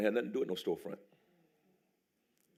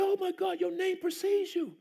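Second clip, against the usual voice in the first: gushing director; slower than usual. Retold or imitated. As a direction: exclaim it like a fan. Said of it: "He said".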